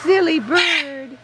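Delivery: slow, fond teasing of a pet. Real, not acted. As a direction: tease the bird gently and slowly.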